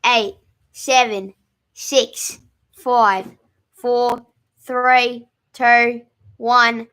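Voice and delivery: loud, hammed-up Australian countdown; Australian accent. Co-host counts down for quiz players on request, Australian accent. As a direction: call time loudly in a put-on Australian accent. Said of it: "I'm gonna have to do Australian".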